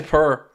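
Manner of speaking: poor said as purr, like a cat purring; Irish pronunciation. Shown as a demonstration. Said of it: "sounds like a cat purring".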